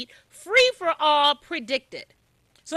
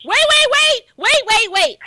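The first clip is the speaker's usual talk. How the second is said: loud, high burst of repeated waits; urgent interruption; 7 semitones higher, 7 dB louder than usual. Real, not acted. Host halting a guest mid-point, urgent loud interruption. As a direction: cut in forcefully over the speaker.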